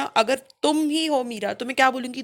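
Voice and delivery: ordinary talk, nothing marked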